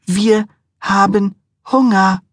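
high, slow, drawn-out whine; drawn-out whiny demand. A narrator's reading. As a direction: stretch out Hunger like a sulking child. Wife repeats, drawn out.

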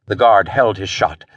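ordinary delivery, nothing marked

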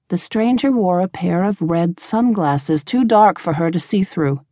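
plain reading voice

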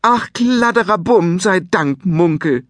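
mother finding her lost son; relieved cry. Acted. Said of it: "schrie Munkels Mutter".

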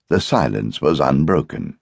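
ordinary delivery, nothing marked

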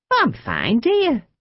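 put-on perky reassurance from a shaken old lady; sprightly old woman brushing it off. A narrator's reading. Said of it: "in sprightly manner".

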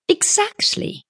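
very high "exactly", rising; young woman's retort. A narrator's reading. Young woman seizes on businessman's liberal terms, pointed. Tuppence seizes on his words.

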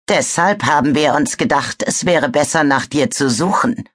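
plain reading voice

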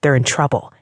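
ordinary delivery

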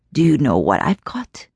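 suddenly lucid. A narrator's reading. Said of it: "suddenly sounding quite lucid".

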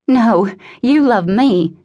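mild narrated scream, slow and high; restrained scream. A narrator's reading. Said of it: "Ariana screamed".

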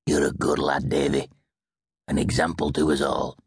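low-voiced fond grandfather; fond old man. A narrator's reading. Grandfather praising his grandson, fond low voice. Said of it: "the old man".

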